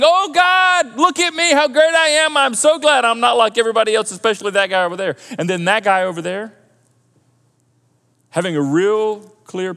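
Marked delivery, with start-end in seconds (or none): 0.0-5.2 high, loud, boastful prayer; prideful Pharisee. Acted. Pharisee boasting to God how great he is, prideful. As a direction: crow to God about yourself, starting high and easing down. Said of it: "the Pharisee in pride saying".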